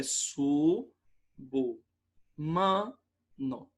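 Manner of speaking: "su, bu, man, no" with a pause after each syllable; correct syllable split. Shown as a demonstration. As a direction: sound out su... bu... man... no, separately. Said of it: "o correto é".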